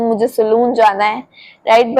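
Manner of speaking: ordinary delivery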